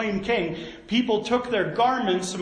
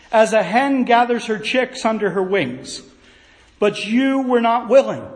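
scripture reading; 4 dB louder than usual. Acted. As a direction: read it with measured grief. Reads Jesus' lament solemnly.

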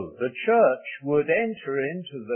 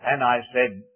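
ordinary talk, nothing marked

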